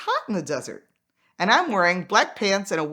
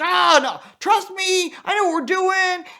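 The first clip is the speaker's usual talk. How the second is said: high, cocky brush-off; higher pitched mock confidence; mocking the driver; 11 semitones higher, 5 dB louder than usual. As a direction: play the know-it-all reviewer. Imitating overconfident driver.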